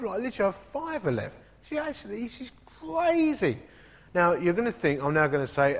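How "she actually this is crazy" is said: disbelief. Retold or imitated. Disbelief at own generosity.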